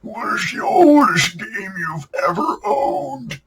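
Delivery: put-on slow, creaky old man asking; hammed-up old geezer voice, drawn out. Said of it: "Not with that old man voice".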